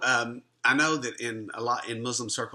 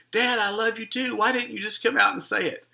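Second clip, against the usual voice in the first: put-on much higher, louder young voice, moved; son's loving reply; 10 semitones higher, 5 dB louder than usual. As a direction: call it out high like an emotional son. Son answers father, emotional.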